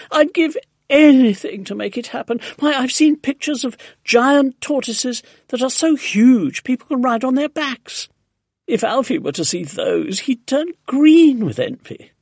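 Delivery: high, loud, gushing enthusiasm; excited crying out. A narrator's reading. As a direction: gush eagerly, pitched right up. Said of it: "Missus Silver cried".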